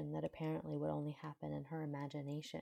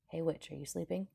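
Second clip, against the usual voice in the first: usual speaking voice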